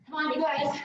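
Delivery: ordinary talk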